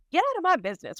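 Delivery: a playful, hammed-up "back off"; annoyed brush-off. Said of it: "that was my first reaction".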